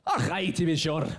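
put-on Pilate in a light Scottish accent. Pilate offering the crowd a prisoner, in a mild Scottish accent. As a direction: play the governor with a hint of Scotland. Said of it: "So I'm gonna be Pilate".

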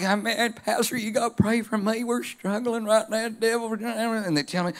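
a hint of a Southern country accent, voicing church folk; Mater's Southern accent. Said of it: "I don't know why I go into Mater".

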